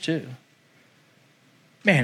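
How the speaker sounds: ordinary delivery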